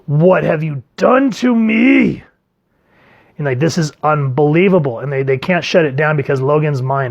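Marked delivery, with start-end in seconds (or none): 0.1-2.2 anguished monster. Retold or imitated. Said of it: "And he goes".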